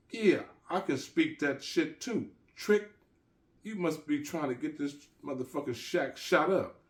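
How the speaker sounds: high, loud, outraged street threats; furious yelling. Acted. Gangsta Jake threatens the Jamaican dealers, high furious yelling. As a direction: blow up at the men behind the gate.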